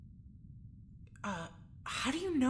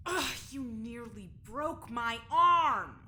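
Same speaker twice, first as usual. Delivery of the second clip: pained complaint; 4 dB louder, slower than usual. Acted. Jules hurt by guard.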